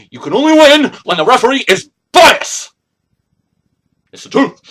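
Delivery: ordinary talk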